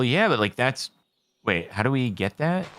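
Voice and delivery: usual speaking voice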